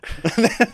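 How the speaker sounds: loud, high burst of laughter; a squeal of real laughter. Real, not acted. Laughing at the pub quiz story, loud and high.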